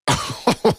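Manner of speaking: high burst of laughter. Real, not acted. Cracking up at a weak shove on screen, laughing.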